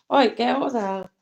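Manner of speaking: scolding the dog. Real, not acted. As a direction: order the dog out.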